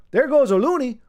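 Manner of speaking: mock Canadian accent. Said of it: "Canada, um, eh? Oh".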